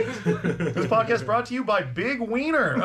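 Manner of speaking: high, slow joke announcer; hammed-up ad tagline through laughter; mock sponsor read. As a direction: pretend to read out a sponsor plug.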